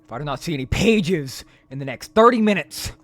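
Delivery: booming mock threat; loud threat. Streamer threatens the player, hammed-up loud threat. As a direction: bellow it like a tyrant. Threat voiced from game text.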